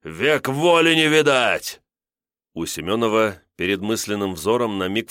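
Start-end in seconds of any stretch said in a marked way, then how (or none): 0.0-1.8 high, slow, drawn-out battle roar; enraged yelling. A narrator's reading. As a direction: yell it out in fury, stretching the words. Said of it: "орал разъярённый Косьма". (яростный протяжный крик)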